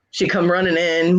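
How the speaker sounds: ordinary delivery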